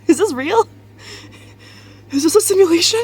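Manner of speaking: high, loud disbelief; surprised. Real, not acted. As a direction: gasp it out, amazed. Excited disbelief.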